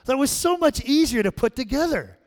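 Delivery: put-on proud kid explaining; excited child. Granddaughter telling grandma what the picture is, high and loud. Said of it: "She goes".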